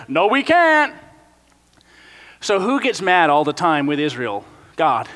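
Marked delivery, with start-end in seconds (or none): 0.1-0.9 much higher, whiny refusal; stubborn Israelites. Pretend. The nation whining back at the leaders, high and whiny. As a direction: whine the refusal. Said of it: "And they're like".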